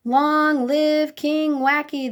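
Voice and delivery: high, loud, slow royal cheer; shouted cheer. A narrator's reading. Crowd cheering their new king, loud and slow. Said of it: "they shouted".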